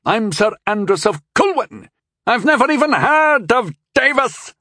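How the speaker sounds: put-on very high, loud old man's voice; panicked old knight, high and loud; insisting old knight. A narrator's reading. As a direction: protest it urgently.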